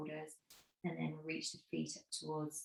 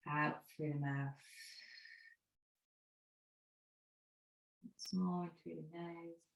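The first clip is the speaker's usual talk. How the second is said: guided meditation. Acted. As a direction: ease the class into stillness, gently. Leads the Shavasana breathing meditation.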